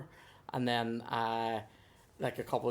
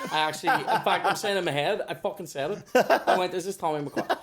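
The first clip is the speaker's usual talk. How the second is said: laughing; 4 semitones higher, 7 dB louder than usual. Real, not acted. Laughing while telling.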